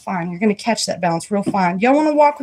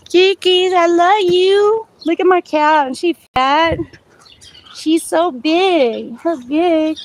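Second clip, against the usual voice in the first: dote on the cat in a sing-song pet voice; pet talk; 6 semitones higher, slower than usual. Real, not acted. Talking to her fat cat.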